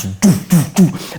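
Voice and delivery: sung doo doo imitating bass; speaker music. Describing the car's speakers, hummed bass imitation. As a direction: mimic booming car speakers. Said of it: "the music in the back's going like this".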